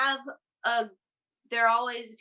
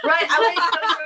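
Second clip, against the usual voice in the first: laughing through the words; 8 semitones higher, 9 dB louder, faster than usual. Real, not acted. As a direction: say it while laughing. Laughing while explaining comfort with death.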